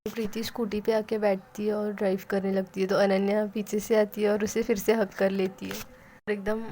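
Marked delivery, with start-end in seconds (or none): none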